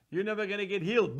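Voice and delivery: usual speaking voice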